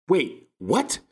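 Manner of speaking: high, loud mock disbelief; mock incredulous. Mocking outrage over the term.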